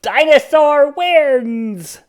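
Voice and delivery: slow, loud triumphant cheer. A narrator's reading. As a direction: announce the victory proudly. Triumphant announcement.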